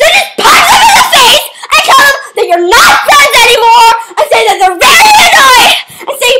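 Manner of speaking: laughing shout. Real, not acted. Host giving advice, shouting and laughing.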